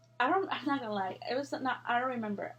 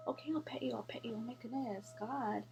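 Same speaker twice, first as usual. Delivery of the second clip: much softer, murmured to a pet; hushed aside; 6 dB quieter than usual. Real, not acted. Vlogger breaking off to pet an animal, hushed aside.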